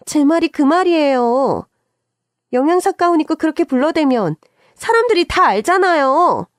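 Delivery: high, whining protest; indignant complaint. A narrator's reading. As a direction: seize the backing and complain harder. Sister-in-law continues the angry quarrel.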